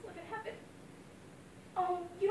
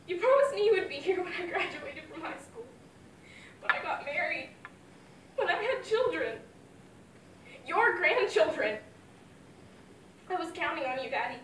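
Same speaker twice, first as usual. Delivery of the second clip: anguished grief; 4 semitones higher, 7 dB louder than usual. Acted. Grieving daughter at father's grave.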